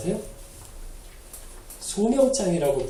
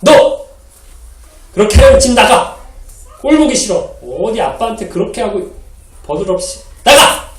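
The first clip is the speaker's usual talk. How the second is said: angry father yelling; 3 semitones higher, 8 dB louder than usual. Acted. Father scolding his rude son, angry shouting. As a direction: yell at your son to get out. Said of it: "소리를 빽 지릅니다".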